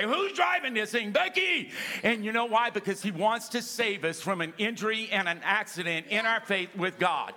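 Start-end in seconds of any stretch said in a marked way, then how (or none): 0.0-1.7 high, raised cry ending in the name; preacher voicing Paul as a startled yelling driver; mock alarmed yell. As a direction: call out like a panicked driver. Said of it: "he's gonna actually get up and say".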